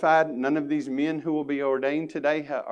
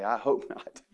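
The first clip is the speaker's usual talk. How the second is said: mock outsider. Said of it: "you'd probably say".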